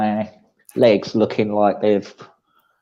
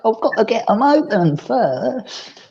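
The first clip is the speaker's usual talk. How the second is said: higher, slow pet talk to a pushy cat; 13 semitones higher, slower than usual. Retold or imitated. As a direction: gently nudge the cat out of the way. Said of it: "and I'll be like".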